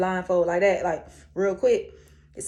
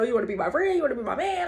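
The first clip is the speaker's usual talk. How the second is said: playful; 6 semitones higher, 4 dB louder, much faster than usual. Retold or imitated. Playful imagined question.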